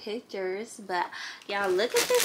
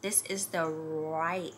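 usual speaking voice

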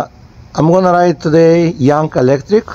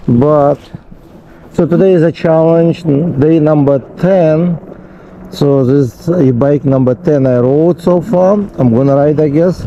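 ordinary talk

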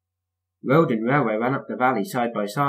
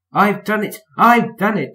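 high, loud, exultant bursts; panted triumph; 10 semitones higher, 8 dB louder than usual. A narrator's reading. Thomas winning in the tunnel, panting triumph. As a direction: crow it out with effort. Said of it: "panted Thomas in the tunnel".

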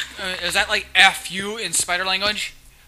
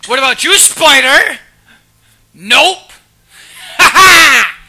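loud, higher crowing; gloating boast; 7 semitones higher, 13 dB louder than usual. Pretend. Player boasting to a spider he cannot die, gloating boast. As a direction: crow in triumph, with a big ha ha.

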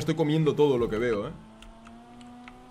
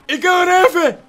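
loud, very high yell; high-pitched shout; 9 dB louder, slower than usual. Acted. Player reacting to a lost race, high shouting. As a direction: shout it out at the top of the range.

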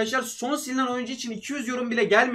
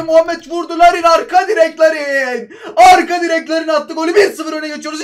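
goal celebration shout; 6 semitones higher, 10 dB louder than usual. Real, not acted. Gamer shouting as his striker scores, ecstatic. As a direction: scream it like the ball just hit the net.

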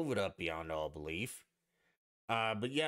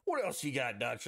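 ordinary talk

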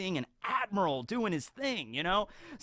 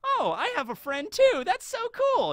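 much higher, wide-eyed and innocent; naive kid voice. Retold or imitated. Kobe answering Full Body, high and wide-eyed. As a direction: gush like an eager little kid. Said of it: "And then Kobe's gonna be like".

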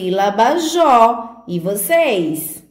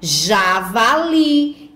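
"javali" joined up in one slow, stretched word; whole word, drawn out; much slower than usual. Shown as a demonstration. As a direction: blend "javali" together slowly without breaking it. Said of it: "Vamos ler com tia?". ("javali" dito inteiro, devagar, com as sílabas ligadas)

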